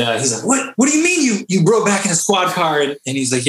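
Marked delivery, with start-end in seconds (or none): 0.5-2.8 high, alarmed question; shocked instructor. Retold or imitated. Instructor hears sailors rode in squad car, shocked and high. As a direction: gasp it out in disbelief.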